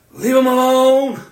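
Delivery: wife screaming at doctors, shout; retold scream. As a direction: yell it to stop the doctors.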